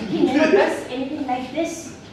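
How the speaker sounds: saddened mother. Acted. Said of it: "mother's really saddened".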